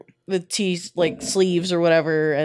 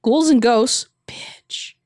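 usual speaking voice

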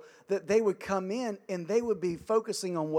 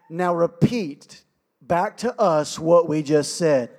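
slow, louder parental order; stern parent; 10 dB louder, slower than usual. Retold or imitated. Parents ordering the boy to repeat, slow and stern. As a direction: spell out the demand slowly. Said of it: "Then they would say".

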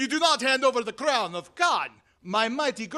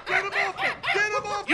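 panicked yell; 10 semitones higher, faster than usual. Acted. Henchman pinned by chimp, crying help.

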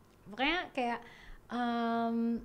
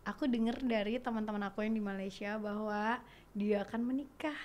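ordinary talk

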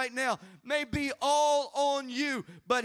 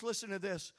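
ordinary delivery

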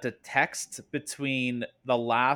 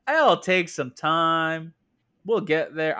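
slow Southern belle reassurance; raised, unhurried mock Southern voice; Yamato; 4 semitones higher, 4 dB louder, slower than usual. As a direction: draw it out in a Southern accent. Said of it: "I don't know why she has an accent with me".

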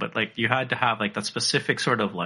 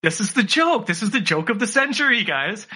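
put-on high, excited child voice; mock kid awe; 8 semitones higher than usual. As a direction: play your dazzled younger self. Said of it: "And I'm like".

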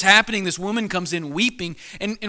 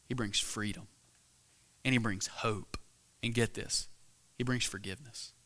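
hushed, tender; 6 semitones lower, 11 dB quieter than usual. Real, not acted. Hushed climax of sermon point.

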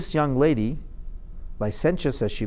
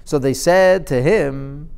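ordinary talk

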